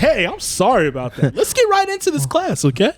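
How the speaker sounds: high upbeat class voice; mock cheerful teacher. Returning to the class after success, high upbeat voice. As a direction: bounce back in, all cheer.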